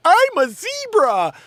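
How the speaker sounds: high, loud, drawn-out mock proclamation; hammed-up high mocking yell; mocking crazed caller. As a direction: crow it slowly in a mocking high voice. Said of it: "mental cases that woke up today and they're like".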